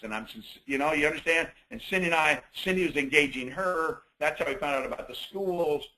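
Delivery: ordinary talk, nothing marked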